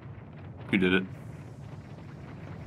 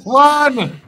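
high, loud urgent yell; panicked shout; 11 semitones higher, 4 dB louder than usual. Real, not acted.

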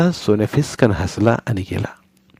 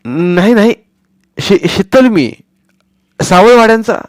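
put-on higher, louder boy's voice tripping over the lie; stammering boy; 11 semitones higher, 6 dB louder than usual. Boy Sonya inventing a false name for grandmother, stammering. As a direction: make up a name on the spot.

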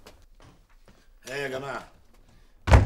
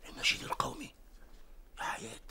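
whispered surprise; 11 semitones higher, 5 dB quieter, faster than usual. Real, not acted.

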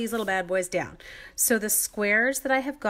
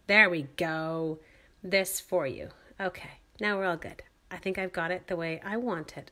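ordinary talk

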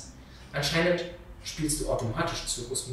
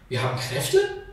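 viel höher, ungläubige Frage; ungläubig überrascht; 5 dB louder than usual. Acted. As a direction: kann es kaum glauben. Schwester erfährt von Kräften. (überraschte, viel höhere Frage)

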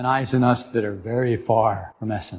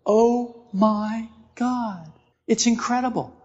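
mock amazement; 8 semitones higher, slower than usual.

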